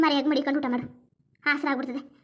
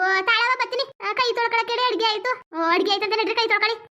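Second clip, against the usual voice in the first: raised, high-pitched call; 5 semitones higher than usual. Acted. Answering and announcing food is ready, high call. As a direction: call the household over to eat. Calling household to wash and eat.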